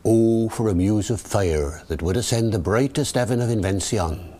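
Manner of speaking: Shakespearean English accent, rhotic R; original pronunciation. Shown as a demonstration. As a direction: Elizabethan original pronunciation reading. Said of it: "David the O P".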